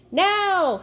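loud, high cue shout; urgent cry. A narrator's reading. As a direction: cry out the signal. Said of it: "he cried".

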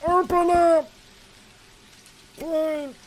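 high loud cry then begging please; mock pleading shout. Locked out, shouting high at the door then begging.

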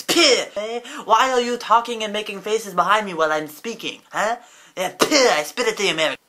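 indignant foreigner. Acted. Asked about the video, spits at the Americans, indignant. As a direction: play an offended foreigner spitting out contempt. Mock foreign director rant over outtakes.

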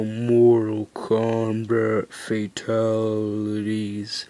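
low, very slow dragged-out voice. Retold or imitated. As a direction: drag it out like slowed playback. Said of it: "it'll go".

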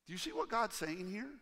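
normal talking voice

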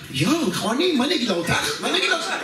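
high, swooping panicked self-talk; flustered panic. Retold or imitated. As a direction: fret aloud in hammed-up, rising panic. Panicking what to tell her. (פאניקה מוגזמת בקול עולה)

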